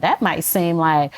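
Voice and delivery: ordinary talk, nothing marked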